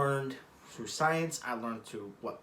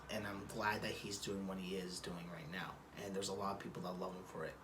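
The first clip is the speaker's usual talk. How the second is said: whispering; 4 semitones lower, 10 dB quieter than usual. Real, not acted. Drops to whisper about brother.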